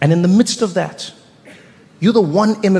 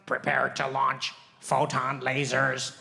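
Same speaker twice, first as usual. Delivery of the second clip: lower, slow, robotic alien pilot countdown; 4 semitones lower, 11 dB quieter, slower than usual. Acted. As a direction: play a stiff alien pilot launching lasers. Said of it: "two aliens in the cockpit".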